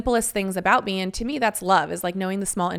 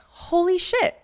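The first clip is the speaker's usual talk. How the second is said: stunned realization; 10 semitones higher, slower than usual. Retold or imitated. Realizing she can't relax.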